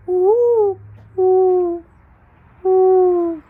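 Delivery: loud, high hooting woo like a dove; mourning dove call. Retold or imitated. Teacher imitates the dove's call for viewers, loud high hoots. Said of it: "They call each other like this".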